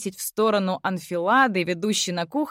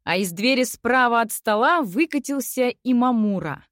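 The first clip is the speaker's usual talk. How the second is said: ordinary delivery, nothing marked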